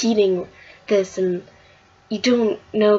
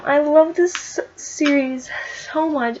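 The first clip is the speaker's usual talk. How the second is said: fond gushing; 7 semitones higher, 3 dB louder than usual. Real, not acted. Gushing over favorite comic.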